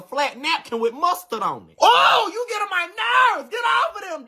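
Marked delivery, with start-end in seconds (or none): 1.8-4.3 hammed-up irritation; exasperated outburst. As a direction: throw up your hands in annoyance.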